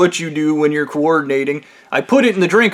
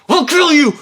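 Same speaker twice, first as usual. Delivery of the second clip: high, loud mock fury; hammed-up manager exploding in rage; enraged threat; 11 semitones higher, 5 dB louder than usual. Manager threatens the coffee-window worker, enraged outburst.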